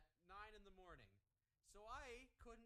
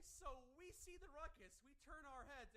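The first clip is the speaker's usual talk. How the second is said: ordinary delivery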